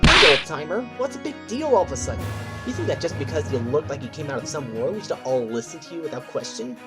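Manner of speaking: high-pitched indignant backtalk; brash challenge. Acted. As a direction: talk back high and defiant, demanding respect.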